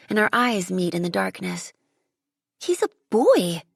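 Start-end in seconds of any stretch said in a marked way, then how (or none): 2.6-3.6 gasp of surprise. A narrator's reading. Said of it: "I gasp in surprise".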